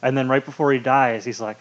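normal talking voice

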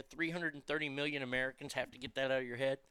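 ordinary delivery

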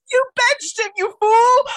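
mock yell. Yelling at Babcock on TV.

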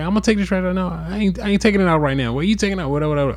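high, fast defensive comeback; retold squabble, firing back in a high voice; bickering retort. Acting out his side of bickering.

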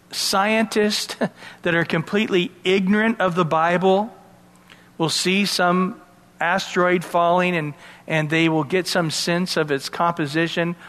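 normal talking voice

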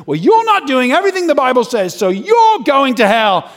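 high, loud street-preacher verdict; imitated soapbox preacher in full cry; condemning shout. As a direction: overrule him loudly, drawing out the judgment. Street preacher overrules the man.

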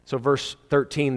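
ordinary talk

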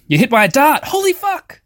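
sudden high startled yell; startled shout. Real, not acted. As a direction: jump at the shock. Sudden dart trap hits.

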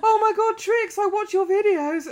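high, loud gushing imitation of a fan; really excited fan. Excited fan coming up to a YouTuber at a festival, gushing high and loud. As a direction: gush as an overexcited fan. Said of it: "like, really excited and went".

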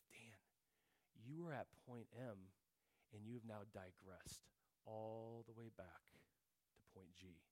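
a hint of a sneering accuser, fading soft and low; accusing enemy voice. The enemy accusing Dan after his sin, quiet low taunt. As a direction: lightly voice the enemy's taunt, dropping away. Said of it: "the enemy would come every single time with".

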